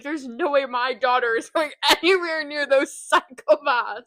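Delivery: loud mock outrage breaking into laughter; outraged father. Will refusing his daughter near Damon's kids, laughing mock outrage. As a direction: play an indignant dad, loud and laughing. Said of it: "Will would be like".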